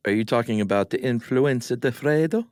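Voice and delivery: hammed-up Italian accent on influenza di freddo. Shown as a demonstration. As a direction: say it like a proud Italian, rising at the end. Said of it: "say it in your famous Italian accent".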